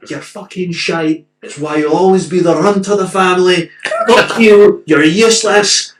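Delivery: loud, high abuse from the stands; screaming angry fan. Retold or imitated. Imitating an old man at the match, angry screaming. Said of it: "the guy was screaming at him".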